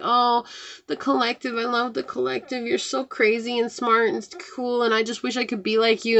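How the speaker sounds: mock sugary flatterer; fake fawning. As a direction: coo the praise sweetly and insincerely. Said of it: "And they're like".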